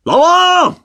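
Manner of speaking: high-pitched call up to the city; hammed-up, high yell across a distance; gleeful taunting shout. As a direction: shout it teasingly, like showing off a prize. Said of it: "朝着城里喊道".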